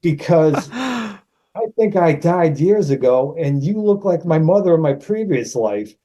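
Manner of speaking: teasing, half-acted sincerity; playful put-on. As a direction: play the odd question straight. Stringing co-star along.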